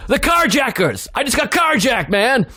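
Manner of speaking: high, frantic outburst; freaking out. Retold or imitated. Carjacking victim explains to confused strangers, freaking out. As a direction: act out a panicked victim pleading to be believed. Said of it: "He's freaking out".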